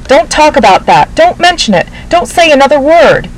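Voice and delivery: urgent hush. A narrator's reading. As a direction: cut them off, frantic and high. Narrator silencing family urgently.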